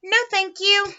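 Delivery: high, polite storybook otter; lightly voiced otter refusal; Miss Otter. A narrator's reading. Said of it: "Miss Otter says".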